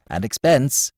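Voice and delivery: ordinary delivery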